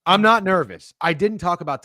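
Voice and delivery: high loud defensive denial. Real, not acted. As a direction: snap back defensively.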